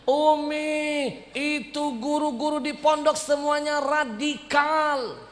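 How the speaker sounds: high, loud, slow whiny fake sob; fake crying. Son fake-crying to his mother, high and loud. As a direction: whine like a crying kid.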